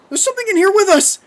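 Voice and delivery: high, piercing terrified scream. A narrator's reading. Kathy feeling something in the water, screaming. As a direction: scream it in full panic. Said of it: "she screamed".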